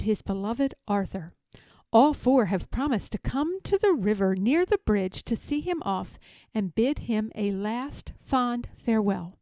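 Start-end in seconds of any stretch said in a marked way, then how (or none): none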